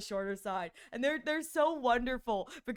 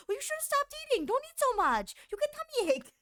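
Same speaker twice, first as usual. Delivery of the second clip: put-on much higher fussing old-grandma voice; scolding grandma; 13 semitones higher than usual. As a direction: chide like a grandma who just overfed you. Grandma voiced scolding after feeding.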